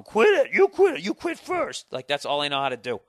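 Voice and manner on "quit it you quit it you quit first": put-on high, whiny childish squeal; mock squeal like a squabbling kid.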